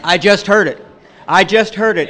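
loud, high, hammered home twice; emphatic realisation. Real, not acted. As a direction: drive the point home to the room.

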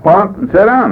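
scolding shout-down, retold; retold gruff order. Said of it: "That's what he said to me".

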